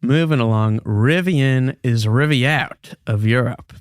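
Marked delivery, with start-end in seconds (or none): none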